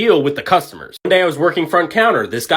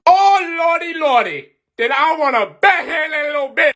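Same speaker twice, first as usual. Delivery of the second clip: much higher, half-shouted disbelief; exasperated outburst; 11 semitones higher than usual. Real, not acted. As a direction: throw your hands up and vent. Exasperated at customer's insult.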